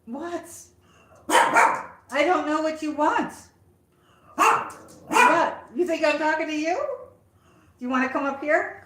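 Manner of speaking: high-pitched, loud questions to the barking dog; high dog-talk. Real, not acted.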